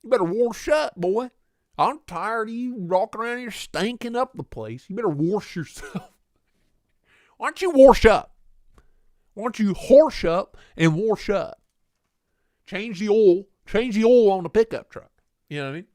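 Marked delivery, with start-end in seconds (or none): 0.0-11.6 hammed-up Southern scolding, pitched up; Southern twang, "wash" as "warsh"; warsh Southern accent. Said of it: "There is no R in warsh". 12.6-15.1 loud, raised Southern twang, "oil" as "awl"; hammed-up country Southern accent. Continues the twang examples with oil.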